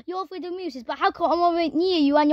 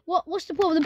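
ordinary talk, nothing marked

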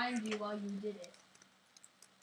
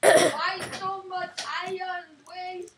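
exasperated shout; 6 semitones higher, 12 dB louder, slower than usual. Real, not acted. Friends bickering over wasted iron.

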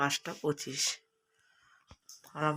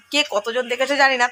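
usual speaking voice

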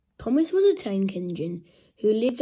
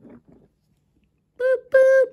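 high, loud imitated train whistle; whistle toot; 10 dB louder than usual. A narrator's reading. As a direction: toot twice like an engine. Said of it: "he whistled".

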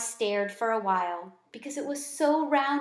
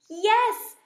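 much higher, louder cry; squeaked yell; 11 semitones higher, 6 dB louder than usual. Acted. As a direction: shout it with all your tiny lungs. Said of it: "I squeaked at the top of my small lungs".

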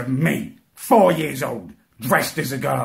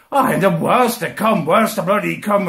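ordinary talk

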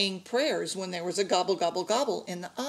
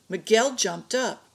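usual speaking voice

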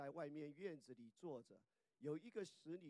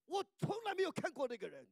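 swearing loudly; 13 semitones higher, 11 dB louder than usual. Retold or imitated. Preacher acts Peter swearing he never met Jesus, high and loud. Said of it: "他说".

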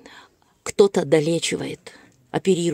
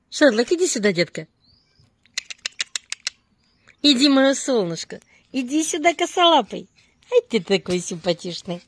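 squeaky praising a dog; 6 semitones higher than usual. Real, not acted. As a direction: squeal affectionately at the dogs. Cooing and calling dogs.

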